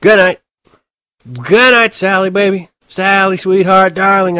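put-on high, loud, slow drunken bellow; drunken shouting. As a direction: slur it loud into the phone, very drunk. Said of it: "I said".

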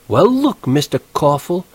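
put-on high, haughty English-accented woman; affected English, primly indignant. A narrator's reading. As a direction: put him off with English airs. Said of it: "getting an English accent".

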